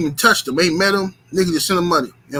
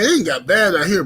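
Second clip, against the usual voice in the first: normal talking voice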